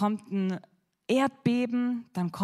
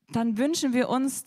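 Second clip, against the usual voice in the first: normal talking voice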